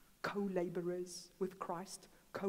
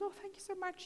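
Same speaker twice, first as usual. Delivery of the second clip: tearful thanks; 9 semitones higher, 5 dB quieter, faster than usual. Retold or imitated. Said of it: "she starts crying".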